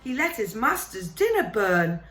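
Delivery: a fretful elf begging Harry to listen; house elf. Acted.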